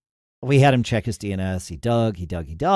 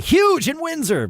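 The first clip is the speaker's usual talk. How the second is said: playful exaggeration. Jokey exaggerated remark.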